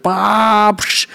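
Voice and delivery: high burst into a splashy hiss; vocal smashing sound effect; imitated crash noise. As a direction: mimic a truck hammering through. Imitates truck hitting the ceiling.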